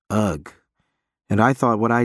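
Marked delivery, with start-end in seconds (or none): none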